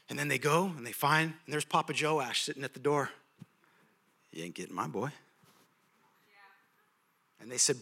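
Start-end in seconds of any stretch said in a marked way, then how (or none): 4.3-5.1 low, soft gruff warning; much lower, softer dad voice; protective old father. Retold or imitated. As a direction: stand your ground quietly. Papa Joash guarding the door.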